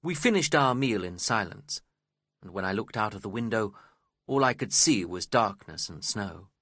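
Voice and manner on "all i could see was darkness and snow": ordinary delivery, nothing marked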